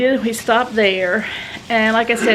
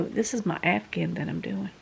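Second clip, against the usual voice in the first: whisper; 3 semitones lower, 10 dB quieter than usual. Real, not acted. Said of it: "aside about her knitting".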